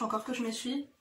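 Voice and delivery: ordinary delivery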